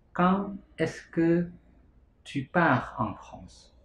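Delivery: "Quand est-ce que tu pars en France?" said word by word, very slowly; slow French drill. Shown as a demonstration. Teacher slowing the French sentence for students. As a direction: break the question into slow clear pieces.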